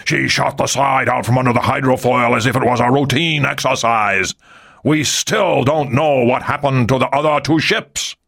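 a hint of a stern naval commander; lightly voiced angry admiral. A narrator's reading. Admiral blaming Ri for his ships, menacing.

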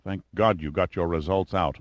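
ordinary delivery, nothing marked

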